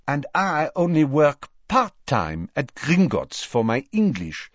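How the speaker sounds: put-on breezy French accent. Fleur's French voice.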